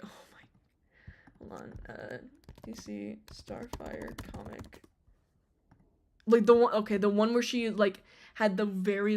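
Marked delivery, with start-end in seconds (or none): 0.0-4.8 whispered in awe; breathless whisper. Real, not acted. Fan awestruck by Starfire, whispering. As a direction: let it slip out as a whisper.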